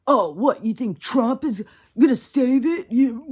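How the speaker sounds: loud, scornful mock retort; mocking sneer. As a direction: sneer it back like a snide commenter. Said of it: "The response would be".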